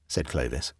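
ordinary delivery

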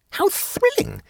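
very high, thrilled squeal of words; high-voiced thrilled woman; excited. A narrator's reading. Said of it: "asked Jane excitedly".

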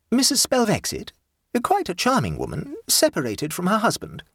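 high, grand society lady; imperious plummy grande dame. Acted. The Duchess speaks.

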